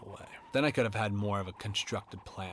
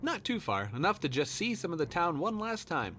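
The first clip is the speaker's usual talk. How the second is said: friendly dad; 8 semitones higher than usual. Acted. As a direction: greet the kid brightly. Dad voiced in story.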